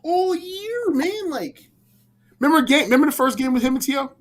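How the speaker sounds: fast and high, voice climbing with excitement; excited high. Real, not acted. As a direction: get carried away remembering it. Excited memory of big game.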